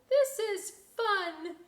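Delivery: high, slow delighted voice; laughing. Acted. Little boat playing in the spray, high and laughing. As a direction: giggle through it.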